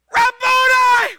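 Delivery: high, loud, slow joyful shout; joyful cry. Retold or imitated. Mary recognizing Jesus, high loud joyful shout. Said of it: "she turned toward him".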